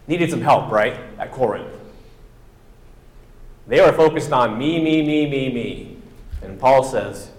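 ordinary talk

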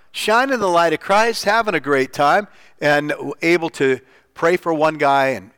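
ordinary talk, nothing marked

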